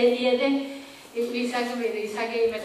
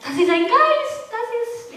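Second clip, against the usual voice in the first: higher, mock terrified outcry; frightened cry; 11 semitones higher than usual. Disciples in the storm crying out in a retold, mock terrified outcry. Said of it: "Die haben ein Geschrei".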